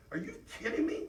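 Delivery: usual speaking voice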